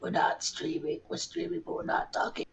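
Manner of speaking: hushed, croaky near-whisper; lost voice. Retold or imitated. Cohost mocks the streamer's wrecked voice next morning, croaky whisper. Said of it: "tomorrow morning it'll be like".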